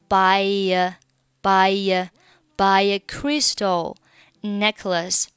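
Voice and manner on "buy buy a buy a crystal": "buy a" with a y glide between buy and a; linking y glide. Shown as a demonstration. Teacher drilling the y link in buy a, step by step. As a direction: slide a y from buy into a. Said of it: "加上一个也的半元音".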